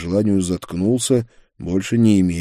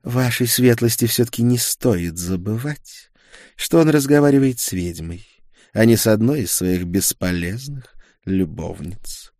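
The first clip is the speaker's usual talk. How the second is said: higher-pitched scornful sorceress; contemptuous; 5 semitones higher, 4 dB louder than usual. A narrator's reading. Witch rebukes His Lordship, contemptuous. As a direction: put the nobleman in his place with disdain. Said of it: "с презрением сказала Гера".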